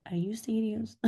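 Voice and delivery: incredulous near-whisper; hushed disbelief. Retold or imitated. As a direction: drop to a stunned whisper. Retells disbelief at his text.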